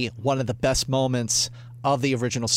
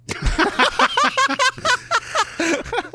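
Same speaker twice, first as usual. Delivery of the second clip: high laugh. Real, not acted. Reacting to his own joke.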